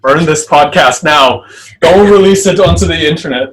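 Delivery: loud, high mock alarm; hammed-up horrified outburst; mock panic. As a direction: cry out as if begging to destroy the evidence. Mock panic after doing the accent.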